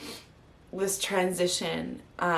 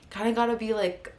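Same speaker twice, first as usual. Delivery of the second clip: ordinary talk, nothing marked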